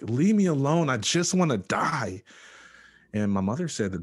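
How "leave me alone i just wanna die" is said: pleading yell, pitched high and louder; retold scream. Patient screaming at the praying nurse to go away, retold yell. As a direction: cry it out high, dragging out the last words. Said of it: "I just kept screaming at this lady".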